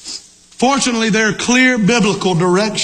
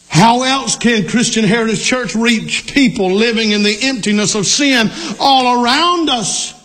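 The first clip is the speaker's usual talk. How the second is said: normal talking voice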